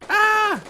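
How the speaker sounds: high loud terrified scream. Acted. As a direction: shriek with eyes shut. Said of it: "she closes her eyes and screams".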